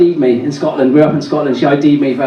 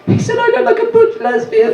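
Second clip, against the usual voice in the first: very high, mock-wounded wail; gutted. Comedian telling the missus about the heckle, mock-gutted high whine. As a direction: squeak it out as if badly hurt. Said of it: "was gutted".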